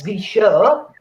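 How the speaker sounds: slow, hammed-up German accent; bad German accent. MC trying to get on with the show, bad German accent. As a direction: put on a badly faked German accent. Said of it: "That's a shit German accent".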